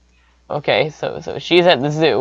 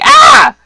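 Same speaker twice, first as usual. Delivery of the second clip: short high, loud mock scream; imitated scream; 10 dB louder than usual. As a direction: let out a piercing acted "ah".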